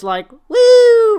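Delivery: high, loud laughing woo; mock rock woo. Mimicking McAfee's rock and roll woo, loud and high. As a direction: hoot it like a rock star.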